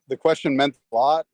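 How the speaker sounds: usual speaking voice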